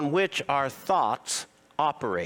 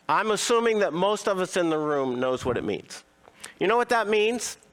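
usual speaking voice

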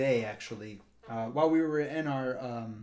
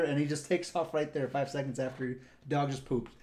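ordinary talk, nothing marked

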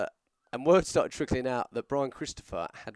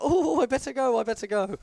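high, a bit louder, hurried teacher voice; flustered old teacher; 13 semitones higher, 6 dB louder than usual. Retold or imitated. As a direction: mimic the teacher rushing to leave. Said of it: "and she was like".

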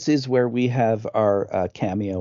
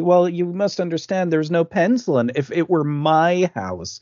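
higher-pitched, lightly mocked lecturing kid; precocious child, imitated; 6 semitones higher than usual. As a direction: explain it like a know-it-all child. Mimics prim film child, raised.

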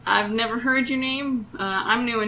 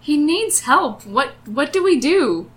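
raised, louder, pressing appeal; urgent plea; 5 semitones higher, 5 dB louder than usual. Acted. Adventurer pleading for the suffering gnome, urgent. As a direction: press them urgently for help. Urging help for gnome in pain.

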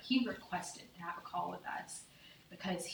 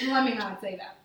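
loud, laughing self-correction; 3 semitones higher, 10 dB louder than usual. Real, not acted. A mother stopping herself mid-confession, laughing loudly. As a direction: laugh while taking it back.

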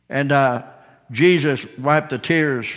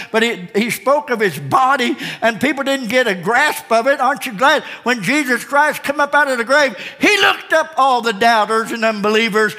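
high, rousing sermon delivery; fervent preaching; 7 semitones higher than usual. Real, not acted. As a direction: build excitement toward the triumph, phrase after phrase. Preaching builds toward the resurrection.

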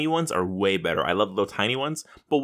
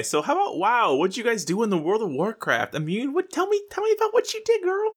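hammed-up squeal; 11 semitones higher than usual. Asking co-host about their Warcraft week, hammed-up squeal. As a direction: pitch climbing steadily to a very high squeak.

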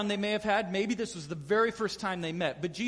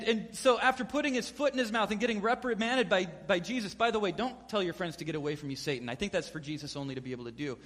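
normal talking voice

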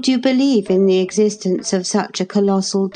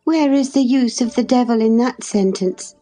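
tittering; 7 semitones higher than usual. A narrator's reading. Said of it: "tittered Linton".

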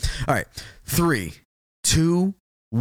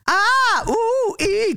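high squealing alarm wail; mock an alarm going off; alarm clock noise; much slower than usual. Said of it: "never heard an alarm clock sound like".

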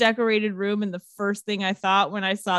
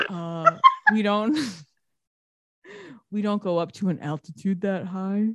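smug dick tone; 3 semitones lower than usual. Retold or imitated. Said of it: "he said it in such a dick way".

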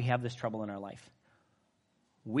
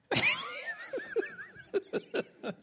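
big laugh. Real, not acted. Laughs at own joke.

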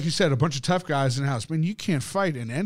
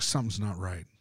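retold uneasy murmur; 6 semitones lower, 8 dB quieter than usual. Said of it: "It's like".

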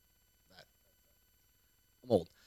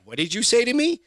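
higher, incredulous retort, mock outrage; indignant retort; 7 semitones higher than usual. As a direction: play it offended and baffled. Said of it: "I fucking was like".

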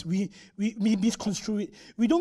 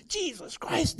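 mock curse exclamation; 8 semitones higher, 5 dB quieter, much slower than usual. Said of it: "they use it as a curse word".

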